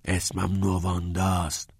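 slow whispered correction; hushed, breath-only insistence. A narrator's reading. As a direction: whisper it deliberately, word by word.

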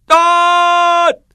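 very high, long, sustained holler; full-force yell. A narrator's reading. Pupil finally lets go for teacher, full-force yell. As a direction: let DAAD rip at the top of the voice.

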